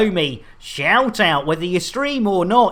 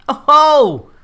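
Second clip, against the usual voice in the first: excited exclamation; 8 semitones higher, 8 dB louder than usual. Real, not acted. Excited reaction.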